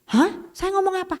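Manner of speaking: surprised pastor. Retold or imitated. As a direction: sound genuinely baffled. (pendeta terheran-heran, nada lebih tinggi)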